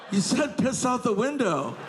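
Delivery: high-pitched, whiny self-defence; defensive protest. Retold or imitated. Comedian defending himself to his soaked friend, high defensive protest. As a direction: throw the blame back, pleading.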